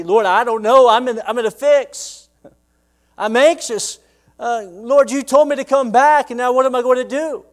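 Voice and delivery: high, loud frightened prayer; anxious plea. Retold or imitated. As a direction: beg God, panicking. Jacob's anxious prayer voiced.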